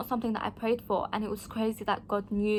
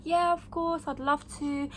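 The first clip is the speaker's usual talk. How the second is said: higher, bright eager acceptance; eager yes; 5 semitones higher than usual. Retold or imitated. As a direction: jump at the offer, thrilled but polite. Eagerly accepting the job offer.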